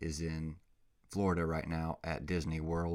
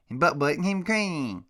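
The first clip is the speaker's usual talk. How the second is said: put-on much higher, louder baby talk; doggy baby talk; 10 semitones higher, 8 dB louder than usual. Owner to his puppy Butt Butt, in loud high baby talk. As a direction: squeal it at the puppy.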